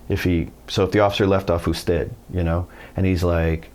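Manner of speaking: ordinary talk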